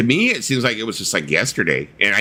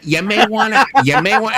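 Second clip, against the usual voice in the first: high, louder glitching repeat; stuttering speaker; 12 semitones higher, 5 dB louder than usual. Retold or imitated. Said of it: "it's gonna stutter like".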